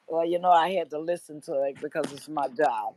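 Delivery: normal talking voice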